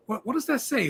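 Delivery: ordinary talk, nothing marked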